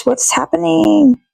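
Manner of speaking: usual speaking voice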